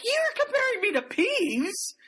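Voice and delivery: high indignant squeal, mock outrage; lightly hammed-up indignant twin; offended high squeak. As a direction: squeak it out, insulted. George offended in retold scene.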